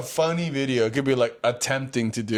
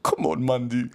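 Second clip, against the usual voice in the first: lightly voiced Grim; a hint of a deep Grim voice. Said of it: "all deep voice".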